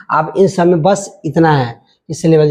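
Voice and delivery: ordinary talk, nothing marked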